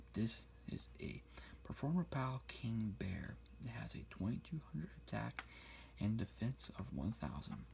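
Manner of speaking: hushed ASMR whisper; whisper in left ear. Acted. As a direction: whisper each word close to the mic.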